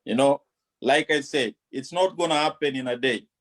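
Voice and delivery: ordinary talk, nothing marked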